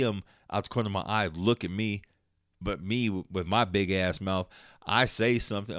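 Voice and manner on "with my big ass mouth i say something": normal talking voice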